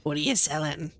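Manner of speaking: mock Resident Evil merchant accent; quoting the game merchant. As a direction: growl it like the shady merchant. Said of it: "game merchant quote".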